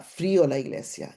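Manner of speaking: ordinary talk, nothing marked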